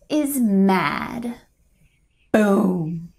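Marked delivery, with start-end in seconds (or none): 2.3-3.1 low dramatic explosion sound; low boom. Acted. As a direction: drop the voice low and make it go boom. Heart exploding with anger.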